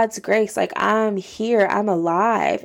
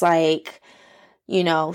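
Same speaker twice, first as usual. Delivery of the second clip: normal talking voice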